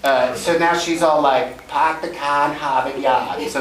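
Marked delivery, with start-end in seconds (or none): 1.7-3.5 imitated Boston accent, slow and r-less; drawn-out mock Boston dialect. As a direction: play a kid trying out Boston. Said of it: "you wanna do a Boston dialect".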